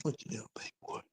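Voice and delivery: whispered softly to the dog. Real, not acted. Whispering to the big dog, soft and hushed.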